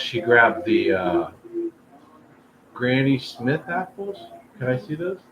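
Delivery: normal talking voice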